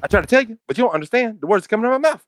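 high-pitched comedian impression, pushed far up; Kevin Hart. Friend riffing on Rush Hour casting, high-pitched celebrity impression. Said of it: "I was trying to sound like Kevin Hart".

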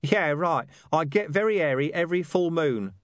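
high-pitched, sarcastic talking-dog voice; dismissive. A narrator's reading. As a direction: brush the question off as a cocky mutt. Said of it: "said the dog dismissively".

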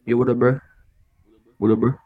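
slightly deepened tough-guy hello; deepened street voice. Shown as a demonstration. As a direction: drop the voice a touch, like out on the street. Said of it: "I'll be deepening it, like, in the street".

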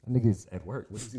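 ordinary talk

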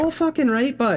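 high, hammed Canadian accent. Shown as a demonstration. Streamer showing the hosts his Canadian chat voice, Canadian accent pitched high. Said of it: "If I'm hitting the chat with".

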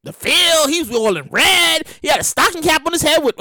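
ordinary talk, nothing marked

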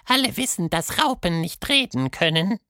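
very high, knowing creature voice; high ironic voice; sly butterfly. Acted. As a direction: play innocent with a wink. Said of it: "antwortete der Schmetterling".

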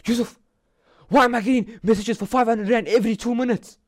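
high, alarmed mother voice; panicked mom asking about charges; mother phoning about the card charges, alarmed; mother on the phone. Retold or imitated. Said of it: "She's like".

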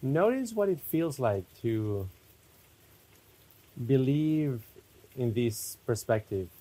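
spacious, unhurried meditation guidance; guided meditation. Real, not acted. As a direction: lead them inward unhurriedly, phrase by phrase.

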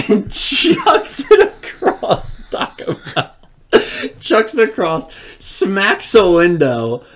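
high, slow, wheezing with laughter; cracking up while telling the story; laughing hard. Real, not acted. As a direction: struggle to get the words out through real laughter. Cracking up telling the burrito throw.

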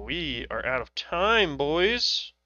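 ordinary talk

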